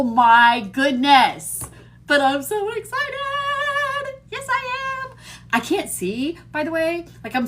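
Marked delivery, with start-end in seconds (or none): 2.1-5.2 excited. Real, not acted.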